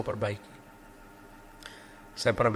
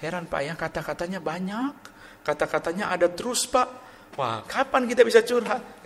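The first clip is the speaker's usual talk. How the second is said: higher, fed-up complaint, rising at the end; exasperated girls; 6 semitones higher than usual. Retold or imitated. Pastor imitating girls complaining about him, high and fed up. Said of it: "Sampai si cewek-cewek bilang".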